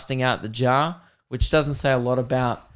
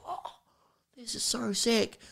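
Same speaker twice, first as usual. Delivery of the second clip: put-on hushed, higher-pitched kid amazed at the cinema; awed kid; 9 semitones higher, 12 dB quieter, slower than usual. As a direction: imitate a kid softly wowed. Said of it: "the kid was like".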